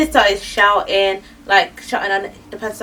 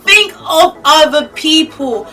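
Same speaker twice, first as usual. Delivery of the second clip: loud, high-pitched comic tirade; exasperated scolding; 4 semitones higher, 7 dB louder than usual. Acted. Vlogger telling off people who eat with hands, loud outraged rant. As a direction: lay down the law, loud and emphatic. Ranting at friend's table manners.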